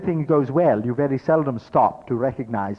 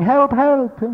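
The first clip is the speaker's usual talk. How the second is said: high loud yells of help; two people shouting up from the hole; yelling for help; 13 semitones higher, 8 dB louder than usual. Acted. Two people stuck in the hole yelling together, loud. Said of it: "there's two of us yelling".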